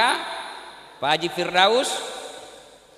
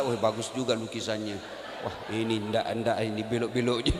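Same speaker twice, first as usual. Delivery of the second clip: inner thoughts; 13 semitones lower, 4 dB quieter than usual. Retold or imitated. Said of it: "Dalam hati kita".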